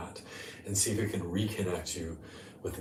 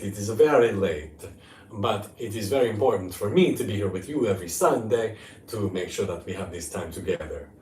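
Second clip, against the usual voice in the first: put-on Italian accent; 2 semitones higher, 8 dB louder than usual. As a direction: play a mock Italian host. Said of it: "that's my Italian accent".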